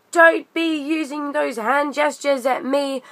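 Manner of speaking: a hammed-up indignant daughter; snappy retold daughter. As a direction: mimic the daughter telling him off. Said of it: "the daughter starts going".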